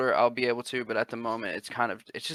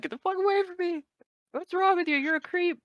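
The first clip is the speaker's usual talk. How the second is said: very high falsetto mock alarm; high-pitched pretend horror; imitated girlfriend recoiling. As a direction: push them away in a put-on squeal.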